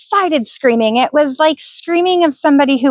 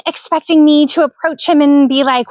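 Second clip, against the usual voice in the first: ordinary delivery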